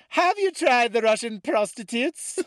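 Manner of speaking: put-on high, squeaky British-accented question, loud; slow, piercing mock-British voice, rising at the end; Galia. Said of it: "say that in her voice".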